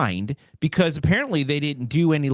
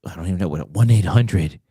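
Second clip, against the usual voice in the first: low, husky phone-sex greeting; sex line voice; 6 semitones lower, 4 dB louder than usual. Acted. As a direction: purr it like a hotline recording. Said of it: "guys can't really do sexy voices".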